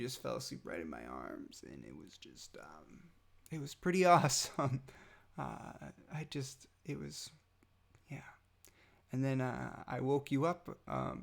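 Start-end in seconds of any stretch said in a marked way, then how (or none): none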